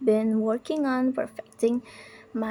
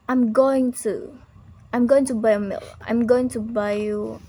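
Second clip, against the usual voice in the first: louder, "going" stressed with a fall; 4 dB louder than usual. Shown as a demonstration. Teacher demoing everyday American emphasis on going. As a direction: push "going" firmly. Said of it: "Americans also emphasize important words".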